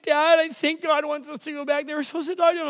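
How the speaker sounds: a hint of a weepy child; imitated crying daughter. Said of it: "She's like".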